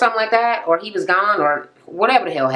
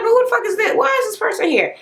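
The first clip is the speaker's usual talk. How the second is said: high, indignant mock squeal; outraged disbelief; 13 semitones higher, faster than usual. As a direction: play the spoiled daughter's disbelief, pitched way up. Kelsey outraged at newcomer.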